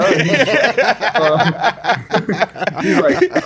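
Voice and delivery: high, breaking into laughter; can barely get it out for laughing; laughing, high and broken-up. Real, not acted. Laughing while describing dad's reaction.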